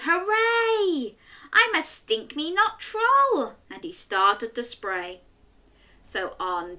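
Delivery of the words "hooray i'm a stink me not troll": happy troll cheer. Acted. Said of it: "The troll roared".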